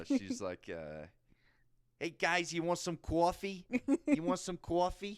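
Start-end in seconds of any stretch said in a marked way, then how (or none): 2.0-5.2 high, loud, drawn-out Jersey grandma; Jersey grandma impression. Grandson imitating his grandma offering coffee, Jersey grandma impression. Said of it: "impression of, like, your grandma".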